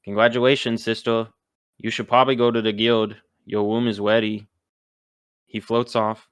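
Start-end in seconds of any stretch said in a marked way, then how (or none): 0.0-4.5 little sibling voice with R and L turned to W; deadpan message in childlike lisped speech; Clink. Acted.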